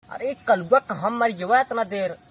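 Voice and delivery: usual speaking voice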